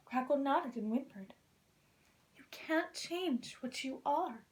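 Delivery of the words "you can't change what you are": soft, slow and kind; tender comfort; kindly. Acted. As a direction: gently reassure the little dragon. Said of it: "I said kindly".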